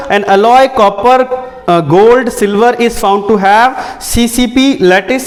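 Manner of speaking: slow, drawn-out reading with held word endings; question read-out. Real, not acted. Teacher reading an exam question to students, slow read-out. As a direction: read it slowly, stretching key words.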